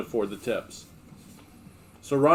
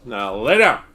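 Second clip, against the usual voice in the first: firm command; 2 semitones higher, 5 dB louder than usual. Real, not acted. Commanding the dog.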